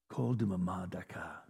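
hushed, low near-whisper; calm quiet voice. Shown as a demonstration. Preacher voicing the still small voice, hushed. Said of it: "quiet voice".

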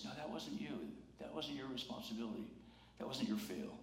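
very soft, soothing voice; tender reassurance. Acted. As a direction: gently lift the blame off them. The right voice absolves the guilty.

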